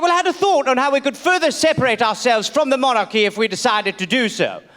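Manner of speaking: mock formal British accent; old British gentleman. Colonist pitching his idea to a friend, mock British accent. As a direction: propose it like a stuffy colonial Englishman. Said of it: "He's like".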